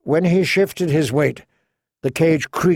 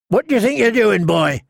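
a higher, sharp challenge; mild snap; 7 semitones higher, 3 dB louder than usual. Acted. Townsman snaps at the girl at the fountain. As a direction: stop the boy short. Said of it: "the townsman snapped".

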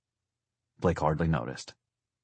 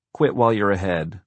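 louder, amused put-down; 3 semitones higher, 7 dB louder than usual. A narrator's reading. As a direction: brush off a prank-calling child. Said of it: "She sounded more amused than ever".

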